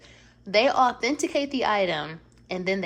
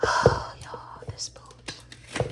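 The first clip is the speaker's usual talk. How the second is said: breathy whisper of adoration; awed whisper; much slower than usual. Real, not acted. Showing viewers the boot, a reverent whisper. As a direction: whisper it like it's too good to say aloud.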